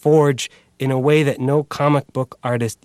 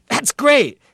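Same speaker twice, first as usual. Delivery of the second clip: excited; 4 dB louder than usual. Acted. Excited outburst about the idea.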